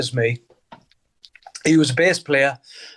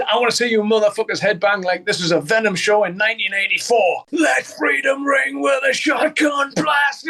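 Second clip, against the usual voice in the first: higher, slowing shout to a crowd; frontman shout; 5 semitones higher than usual. Retold or imitated. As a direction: rouse the arena like a frontman. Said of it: "he says".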